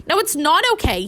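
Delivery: angry friend bellowing at the injustice. A narrator's reading. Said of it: "he bellowed".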